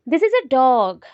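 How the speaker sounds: "dog" drawn out and dropping; statement tune, down on "dog"; falling intonation. Shown as a demonstration. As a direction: start firm, let DOG fall away long. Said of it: "पहले जोर start किया, then fall किया".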